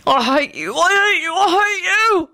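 high yell rising with each repeat; escalating high-pitched tantrum cry; hateful yell. A narrator's reading. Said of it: "William yelled".

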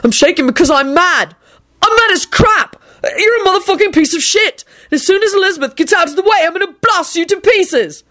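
high-pitched raging rant; acted frenzied fury; wild shouting. A narrator's reading. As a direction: rage and threaten, pitch way up. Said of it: "Neville shouted wildly".

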